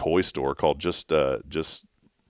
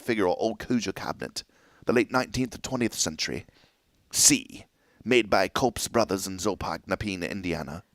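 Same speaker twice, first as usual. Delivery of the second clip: quiet, old-sounding fake British; mock British accent; 6 dB quieter than usual. Host reads cabinet provenance to co-host, in mock British accent. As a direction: read like a pretend British cataloguer. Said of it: "I don't even know what accent this is".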